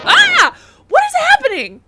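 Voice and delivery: loud, high startled scream; scared. Real, not acted. Streamer scared mid-apology, screaming in alarm. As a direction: shriek in sudden fright. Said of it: "scared me".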